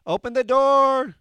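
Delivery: shouted high and loud toward the door; calling out. Real, not acted. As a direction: call across the room to someone outside.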